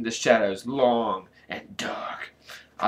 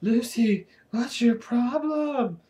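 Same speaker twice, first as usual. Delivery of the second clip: put-on muttered boy voice; 11 semitones higher, slower than usual. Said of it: "Aaron muttered".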